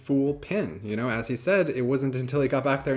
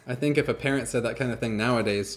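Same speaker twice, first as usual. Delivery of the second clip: ordinary talk, nothing marked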